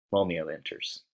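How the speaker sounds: ordinary delivery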